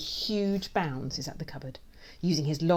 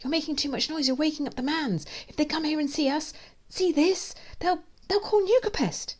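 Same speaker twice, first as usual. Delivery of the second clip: high-pitched, climbing alarm; panicked warning; 13 semitones higher, 4 dB louder than usual. A narrator's reading. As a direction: plead with mounting panic.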